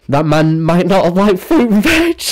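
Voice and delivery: loud, high, rising outburst; mock impassioned eulogy. Mourner at Big Mikey's funeral, mock impassioned cry. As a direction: build to a hammed-up wail.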